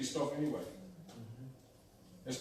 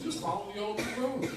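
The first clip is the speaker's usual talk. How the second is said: higher, sing-song advice; advising voice; 6 semitones higher than usual. Retold or imitated. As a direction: say it like a well-meaning chorus. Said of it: "And everybody told her".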